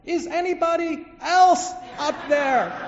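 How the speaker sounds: very high, loud, slow shout; yelling skyward. Acted. Man rejects God's answer, shouting high and loud. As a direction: holler up for another option. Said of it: "yells out".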